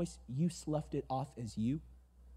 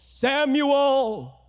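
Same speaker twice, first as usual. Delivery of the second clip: loud far-off call; calling out by name; 10 semitones higher, 11 dB louder than usual. Retold or imitated. God calling Samuel by night, a loud call. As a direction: call his name across the dark.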